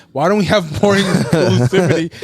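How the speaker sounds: laughing mid-sentence. Real, not acted. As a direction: laugh through the word inclusivity. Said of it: "I know I sound wild saying that".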